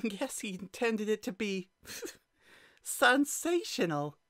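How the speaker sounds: high, laughing through words. Acted. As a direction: giggle out the punchline. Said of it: "let out a peal of laughter".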